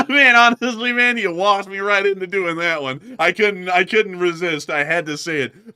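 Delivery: loud, high, cracked up; laughing. Real, not acted. Host excuses his joke to the other, laughing loudly. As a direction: protest through real laughter.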